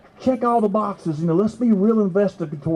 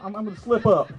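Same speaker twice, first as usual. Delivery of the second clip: usual speaking voice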